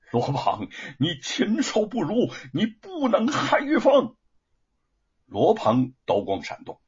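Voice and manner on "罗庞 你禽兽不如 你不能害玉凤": helpless rage climbing high in pitch; furious cursing. A narrator's reading. Bound husband cursing Luo Pang, furious and helpless. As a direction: curse him, building to a cry. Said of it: "只有怒骂不休".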